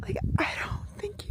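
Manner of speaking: breathless whispered awe; hushed amazement; awed whisper. Real, not acted. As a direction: whisper it, stunned by the view. Awed at Grand Canyon view.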